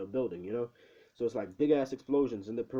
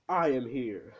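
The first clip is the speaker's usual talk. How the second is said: lightly voiced booming hero announcement; a hint of a grand hero's arrival; mock heroic entrance; 4 dB louder, slower than usual. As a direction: announce yourself like a hero, half in earnest. All Might's entrance line acted out.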